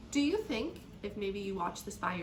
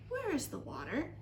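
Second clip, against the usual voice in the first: higher, small creature voice; small raspy voice; 5 semitones higher than usual. A narrator's reading. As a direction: ask in a tiny, raspy voice. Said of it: "said in a small, raspy voice".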